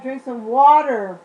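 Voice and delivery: loud and slow on water; emphatic command. Real, not acted. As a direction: lean hard on the last word. Final emphatic advice.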